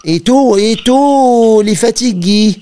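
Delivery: high, loud put-on Russian accent; prank-call voice with a Russian accent; russian accent in french. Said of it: "si je lui parle avec un accent, euh, russe".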